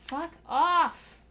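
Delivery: loud high angry yell; furious shout. Real, not acted. Fed up with the game, a real furious yell. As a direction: blow up at it.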